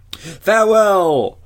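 long, high, loud farewell call; cried farewell. A narrator's reading. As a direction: draw each word out as a ringing cry. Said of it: "cried Perseus".

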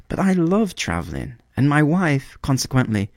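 a small bird grumbling about his lady love; swallow complaining. A narrator's reading. Swallow grumbles about the reed, high fussy bird voice.

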